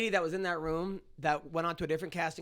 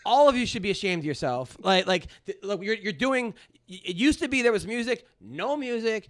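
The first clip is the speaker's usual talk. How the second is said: louder, higher mock scolding; retold rant; 3 semitones higher, 6 dB louder than usual. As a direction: shame the whole room in a staged outburst. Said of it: "I went off on the whole thing".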